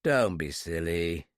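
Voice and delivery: low, slow and tired; weary. A narrator's reading. Kidnapper wearily dismisses the girl's plea. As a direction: brush her off wearily. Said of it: "I said wearily".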